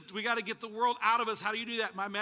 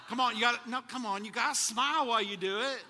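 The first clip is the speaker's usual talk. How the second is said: usual speaking voice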